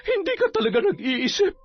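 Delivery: high-pitched angry character voice, rushing; angry scolding. A narrator's reading. Sonia demanding the baby from Matet, angry scolding. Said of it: "galit na sinigawan si Matet".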